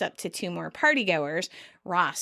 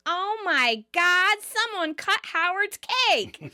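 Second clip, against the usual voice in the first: put-on much higher, slow, quavering old lady gasp; old lady guest; 9 semitones higher, slower than usual. Old lady guest discovering Howard's cut cake, high and slow. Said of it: "I just like her voice".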